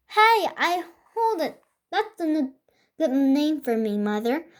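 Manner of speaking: high, bright-eyed new idea; excited. Acted. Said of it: "very excited".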